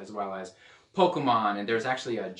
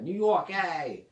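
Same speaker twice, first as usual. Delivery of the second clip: slow, higher New York accent, hammed up; 6 semitones higher, 4 dB louder, slower than usual. Shown as a demonstration. Preacher hamming a New York accent for laughs. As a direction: cheer New York like a caricature local. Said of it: "I didn't do the accent for that".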